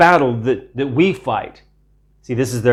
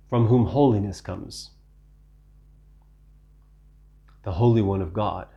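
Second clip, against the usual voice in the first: much softer and lower, awed; reverent hush; 5 semitones lower, 7 dB quieter than usual. Acted. Preacher savoring Christ's holiness for the congregation, hushed awe. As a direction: let it sink to near hush.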